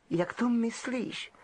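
high, slow, prim question; stiff, suspicious girl; stiffly. Acted. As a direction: Ravenclaw girl answering stiffly. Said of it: "řekla dívka upjatě".

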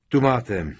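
short, forceful offer; gruff boom. A narrator's reading. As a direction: boom it out as you hand it over. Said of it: "Zahučel".

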